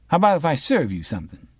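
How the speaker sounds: high and quick, slippery barkeep; high bar owner voice. Acted. Mister Sweety dodging Raymond's questions, high and quick. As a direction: dodge him with a fast high offer.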